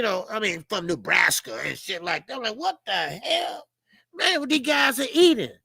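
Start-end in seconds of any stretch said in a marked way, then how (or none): 2.6-5.5 acted amazement. Fan watching huge college football players, astonished. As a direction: marvel in a raised, incredulous voice.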